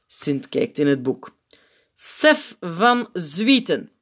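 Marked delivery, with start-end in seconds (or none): 1.9-3.9 slow, high roll-call announcement; formal, drawn-out name call; reading out loudly. A narrator's reading. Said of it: "leest hij luid op".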